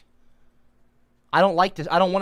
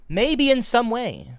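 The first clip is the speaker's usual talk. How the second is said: "Maybe in some way" drawn out and higher, mocking the hedge; stress on maybe; 5 semitones higher, slower than usual. Shown as a demonstration. Reading the article's hedge back, slow and scornful. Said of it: "Not maybe in some way".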